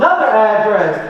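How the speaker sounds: ordinary talk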